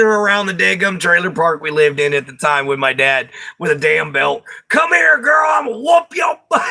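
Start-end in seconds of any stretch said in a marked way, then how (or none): 4.7-6.6 angry dad shouting. Retold or imitated. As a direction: holler it like a dad chasing his kid with a belt. Dad chasing daughter with belt.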